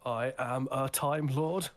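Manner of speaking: slow, loud and lofty, each word drawn out; grandiose Doctor proclamation. Acted. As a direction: declare it like a godlike Time Lord. Grand Doctor line reenacted.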